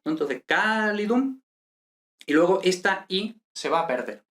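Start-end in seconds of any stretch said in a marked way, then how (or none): 0.5-1.4 "calidum" with a long, stressed CA; stress on first syllable. Shown as a demonstration. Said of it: "esdrújula".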